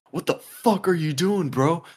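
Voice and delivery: high-pitched mock yell at the attacker; retold angry yell. Confronting the man grabbing the lady, high angry yell.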